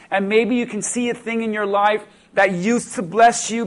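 ordinary talk

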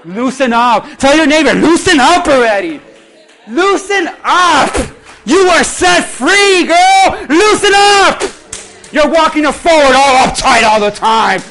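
loud, high impassioned preaching; preaching at full force. Acted. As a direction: drive it home to the congregation.

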